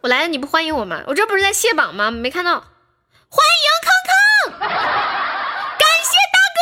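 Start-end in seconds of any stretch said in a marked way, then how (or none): 3.3-6.6 excited shout. Real, not acted. Streamer greets big gifter excitedly.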